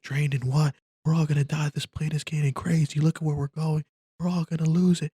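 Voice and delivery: very high-pitched, frantic voice; panicked passenger. Acted. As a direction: act out a man losing his mind mid-flight. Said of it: "this passenger was just out of his mind".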